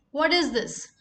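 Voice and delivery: normal talking voice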